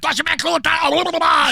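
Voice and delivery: high, loud hype yell like a DJ on stage; mock stage shout. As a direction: bark it out to the crowd, hammed-up. Imitating Rob yelling on stage.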